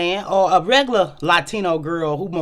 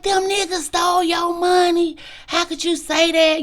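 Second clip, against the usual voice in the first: upset outburst; 12 semitones higher than usual. Retold or imitated. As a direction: cry out, hurt and indignant. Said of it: "And I was like".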